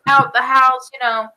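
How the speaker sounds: ordinary talk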